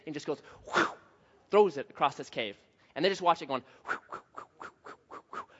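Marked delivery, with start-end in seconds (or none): 3.9-5.5 soft, voiceless repeated whooshes; breath-only flying sound effect; imitated whooshing. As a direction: puff out the whirl of a thrown object. Imitating a thrown flashlight flying.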